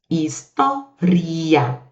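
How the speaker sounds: "História" very slow, split into syllables. Shown as a demonstration. Teacher shows students why it takes an accent, "História" syllable by syllable. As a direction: break "História" apart, stretching each piece. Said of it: "É por isso que a gente acentua".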